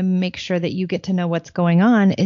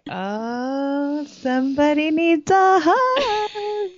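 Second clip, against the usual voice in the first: put-on high, slow sing-song baby talk; sing-song mom; 10 semitones higher, much slower than usual. Mother cooing at her cranky daughter, high sing-song. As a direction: coo it sweetly, teasing a grumpy kid. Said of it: "And I'll just be like".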